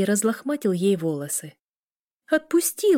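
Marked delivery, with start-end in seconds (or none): 2.3-3.0 high-pitched protest; protesting squeal. A narrator's reading. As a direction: demand release in a much higher voice. Sister protests at brother's grip.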